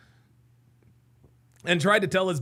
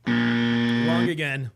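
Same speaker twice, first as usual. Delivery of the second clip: slow mocking taunt; 11 semitones lower, much slower than usual. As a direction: drag it out very slow and low, teasing. Taunting the guest in the clip.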